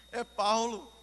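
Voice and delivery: loud, punched announcement; emphatic repeat. Retold or imitated. Preacher repeating the driver's name as a revelation, emphatic. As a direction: re-enact the answer with big emphasis.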